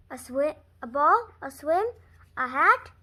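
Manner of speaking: ordinary delivery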